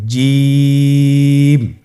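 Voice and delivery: জিম held long over three alifs; drawn-out Jim. Shown as a demonstration. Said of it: "দেখুন আমি কিভাবে উচ্চারণ করছি". (তিন আলিফ টেনে জিম)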